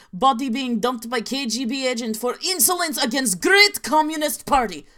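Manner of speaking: hammed-up inaccurate Russian accent; a deliberately bad Russian accent, played for laughs. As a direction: read it like a comic Soviet narrator with a bad Russian accent. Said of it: "who's ready for an inaccurate Russian accent".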